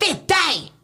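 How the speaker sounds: lightly hammed-up boyfriend voice; mock boyfriend command. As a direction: bark his order in a silly imitation. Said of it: "he'd be like".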